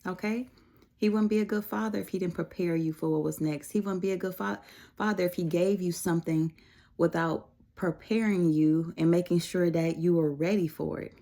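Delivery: ordinary talk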